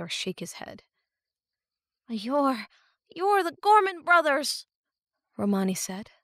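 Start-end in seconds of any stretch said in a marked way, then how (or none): 2.1-4.7 put-on startled young girl. A narrator's reading. Romani recognizes captors.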